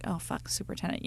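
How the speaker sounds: very quiet, voiceless aside as the students; mock dismay, dropped almost to nothing; dismayed mutter in imitation. Imitating students facing a yelling superintendent, hushed dismay.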